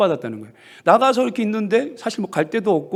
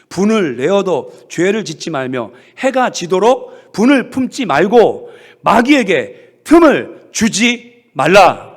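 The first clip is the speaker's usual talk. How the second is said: 선포하듯 낭독; 6 dB louder, slower than usual. Acted. 말씀을 천천히 선포함.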